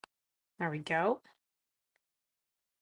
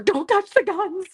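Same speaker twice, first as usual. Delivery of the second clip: panicked order; 10 semitones higher, 5 dB louder than usual. Retold or imitated. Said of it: "And then I'm like".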